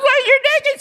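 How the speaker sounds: high squeal through laughter; laughing. Retold or imitated. Wife laughing at naked husband on the balcony, high giggling squeal. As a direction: laugh it out as you notice. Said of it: "She starts laughing".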